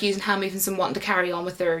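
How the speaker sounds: ordinary talk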